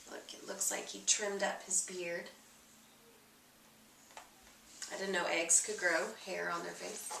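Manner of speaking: soft aside. Real, not acted. Reader commenting on the picture to a child, quiet. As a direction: lean in and murmur about the picture.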